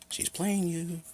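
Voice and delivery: high, drawn-out teasing warning; imitated friend's warning. Benjamin warning him about her, high drawn-out warning.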